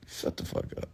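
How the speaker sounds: very soft, whispered dismissal; muttered retort. Retold or imitated. Streamer's retort to listeners complaining about cursing, muttered. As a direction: say it under your breath.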